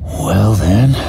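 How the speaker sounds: a grim, resigned sigh of a line; bleakly. Acted. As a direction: say it like a man with no better option. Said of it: "he said bleakly".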